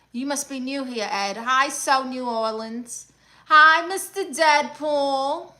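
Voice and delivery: usual speaking voice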